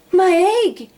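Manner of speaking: high, loud cry; shout. A narrator's reading. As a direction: shout it out in alarm. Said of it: "shouted Horton".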